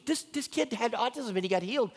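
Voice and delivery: mock-astonished boss, pitched high; teacher's boss. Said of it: "and she goes".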